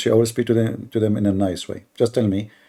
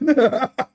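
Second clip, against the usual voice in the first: high laugh. Real, not acted. Laughing at the question.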